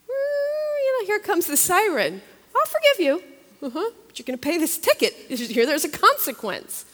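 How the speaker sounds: God teasing a speeding believer, high and loud; playful voice of the Lord. Retold or imitated. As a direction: play God gently catching her out, pitched high. The Lord answers her excuse.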